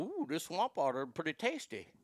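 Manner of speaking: lightly put-on Cajun accent; half-done Cajun voice; mock Cajun. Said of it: "why they turn Cajun all of a sudden".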